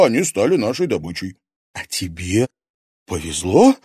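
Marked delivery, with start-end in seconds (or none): 1.7-3.8 bitter, hateful question. Acted. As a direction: spit it out with hatred. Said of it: "С ненавистью спросила Луиза".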